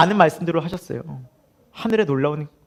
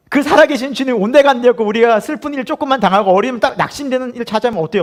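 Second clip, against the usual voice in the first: ordinary talk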